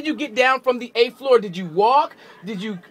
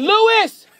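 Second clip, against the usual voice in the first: high, raised-voice holler for someone; calling out; 9 semitones higher, 4 dB louder than usual. Real, not acted. Radio host summoning a colleague on air, calling out. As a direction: holler the name to summon them.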